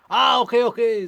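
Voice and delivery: high, loud eager okay from the neighbor; relieved neighbor. Retold or imitated. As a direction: answer like a relieved neighbor finally getting it. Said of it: "he was like".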